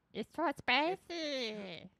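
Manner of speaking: high, very slow silly squeak; squeaky character voice. Pretend. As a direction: drag it out in a cartoon squeak. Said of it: "It's the only voice I can do".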